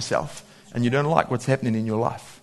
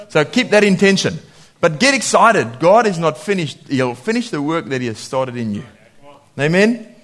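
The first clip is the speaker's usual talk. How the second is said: usual speaking voice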